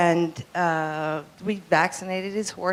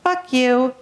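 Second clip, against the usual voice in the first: higher, louder cockatoo squawk; talking bird; 7 semitones higher, 5 dB louder than usual. Retold or imitated. Cockatoo answers the technician's hello, imitated bird voice. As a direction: curse like a pet cockatoo. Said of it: "And the bird says".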